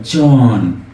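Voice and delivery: "chon" said with emphatic falling intonation to mean very early. Shown as a demonstration. Teacher showing students how "chon" means very early, emphatic fall. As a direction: lean into "chon" with a strong falling tune. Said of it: "the emphasis is in the intonation".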